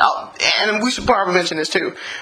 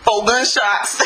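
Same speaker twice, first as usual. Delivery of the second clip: normal talking voice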